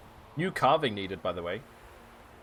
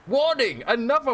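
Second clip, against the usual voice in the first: loud, much higher warning shout; mock alarm cry; 12 semitones higher, 6 dB louder than usual. Reading warning signs aloud.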